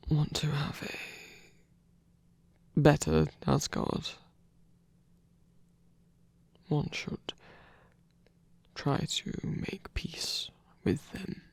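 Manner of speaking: slow, breathy hush; whisper. Acted. A hesitant god whispering to the one he trusts. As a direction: confide it slowly under your breath. Hushed confession to listener.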